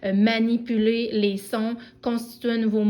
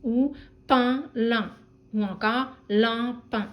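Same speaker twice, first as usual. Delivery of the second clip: slow syllable demo; much slower than usual. Shown as a demonstration. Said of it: "On pourrait, par exemple".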